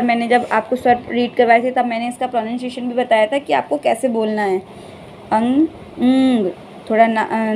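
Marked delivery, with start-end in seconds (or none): 5.3-6.5 अंग said slowly with the ंग sounded through the nose; nasal demo. Shown as a demonstration. Teacher showing a child the nasal sound in अंग. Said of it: "नाक से touch होके ही बोलते हैं".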